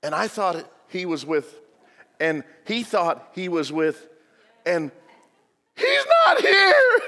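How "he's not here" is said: put-on frantic high shout of a missing child; panicked shout. Parent screaming that the boy is gone. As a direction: cry out in panic, high and loud.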